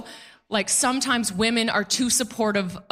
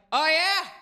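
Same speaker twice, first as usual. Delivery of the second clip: put-on drunk guy; 7 semitones higher than usual. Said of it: "He's like".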